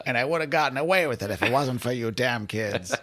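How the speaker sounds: lightly voiced cartoon villain grumble; Scooby-Doo villain. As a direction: growl it like an unmasked cartoon crook. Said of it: "I would be like a Scooby-Doo villain".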